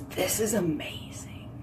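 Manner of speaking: hushed, unvoiced whisper; whispered inner thought. Remembering first morphine press, whispering to herself in awe. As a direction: whisper it like a guilty secret. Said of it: "And right then I was like".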